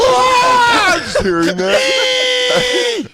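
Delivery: high, loud, slow laughing cry; real laughing shout. Real, not acted. Cohost shrieks with laughter reacting to the clip.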